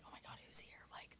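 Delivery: hushed, nervous whisper; anxious hushed aside. Retold or imitated. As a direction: whisper it like you fear being overheard.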